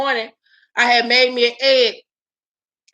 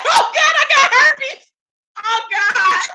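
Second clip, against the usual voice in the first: panicked shriek; 10 semitones higher than usual. Retold or imitated. Finding a bump on her lip, she shrieks in high panic. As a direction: shriek it out in mock terror.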